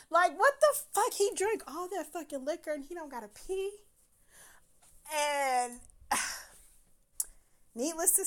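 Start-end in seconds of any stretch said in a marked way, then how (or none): 0.1-3.7 higher, louder disbelief; incredulous outburst. Retold or imitated. Wondering why the drinking date never needs to pee, exclaimed. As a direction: exclaim it like it's absurd.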